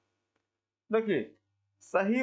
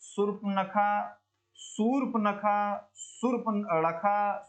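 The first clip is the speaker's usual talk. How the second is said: Shurpanakha drawn out very slowly, each syllable held; slow spelled-out word; much slower than usual. Shown as a demonstration. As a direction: stretch Shurpanakha out slowly for the class. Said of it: "शुद्ध वर्तनी वाला शब्द चुने". (शूर्पणखा को बहुत धीरे, खींचकर बोलना)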